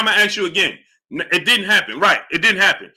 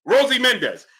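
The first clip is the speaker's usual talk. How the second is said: ordinary delivery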